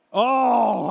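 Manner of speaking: loud, drawn-out "oh"; hammed-up cry of wonder; mock amazement. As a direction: gasp it out big, as if amazed. Acts amazed as exposure returns.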